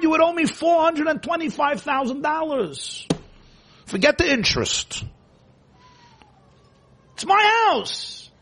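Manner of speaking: a shrill hammed-up parent listing what he pays for; ranting parent. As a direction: play the outraged parent shrieking about his house. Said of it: "In the parent's imagination".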